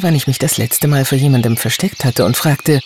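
usual speaking voice

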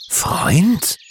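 high rising disbelieving cry; indignant outburst. A narrator's reading. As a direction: blurt the word out in disbelief. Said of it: "entfuhr es Stefan".

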